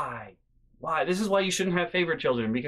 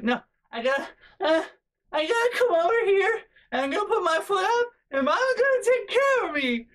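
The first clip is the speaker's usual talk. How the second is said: put-on high, loud whining, childish; hammed-up grown sister whining for Mama; whiny sister; 5 dB louder than usual. Older sister with a bee sting whining for Mama. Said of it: "and she was like".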